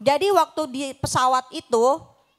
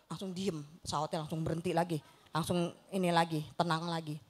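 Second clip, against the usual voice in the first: hushed calm; 10 semitones lower, 11 dB quieter than usual. Retold or imitated. Calm after turbulence story.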